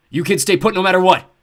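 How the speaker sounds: put-on loud urgent call to the kids. A narrator's reading. Dale calling to the kids, loud urgent shout. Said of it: "he called".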